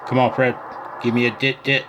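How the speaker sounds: ordinary delivery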